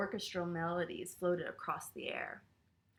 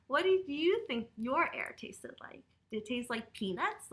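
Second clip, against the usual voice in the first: ordinary delivery, nothing marked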